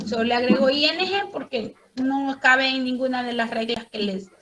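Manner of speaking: usual speaking voice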